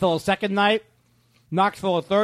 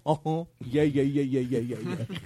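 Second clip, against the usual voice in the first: lazy low uh-huh guy voice; 4 semitones lower than usual. Pretend. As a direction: mumble agreeable uh-huh's. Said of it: "He's the uh-huh guy".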